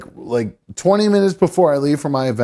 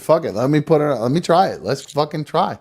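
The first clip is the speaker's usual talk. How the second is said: ordinary talk, nothing marked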